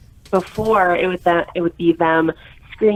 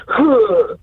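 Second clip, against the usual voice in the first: mock sulky child's huff, pitched up; pouty huff; 4 semitones higher, 4 dB louder than usual. Mother imitating kids who cross their arms, pouty huff. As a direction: huff like a kid in a pout. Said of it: "throw a fit and cross their arms and go".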